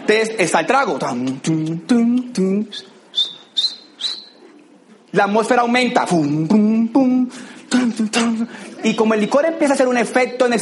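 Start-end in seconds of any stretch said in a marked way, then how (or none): 1.0-2.7 thump out the techno rhythm; mouthed club beat. Retold or imitated. Imitating club music beat. 6.1-8.5 thump out the techno rhythm; mouthed club beat. Retold or imitated. Party atmosphere rising.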